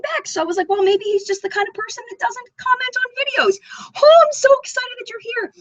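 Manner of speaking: high, gushing greeting to a favourite creator; excited fangirling. Real, not acted. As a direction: squeal a little with real delight. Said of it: "I'm fangirling so much right now".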